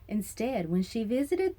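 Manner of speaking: usual speaking voice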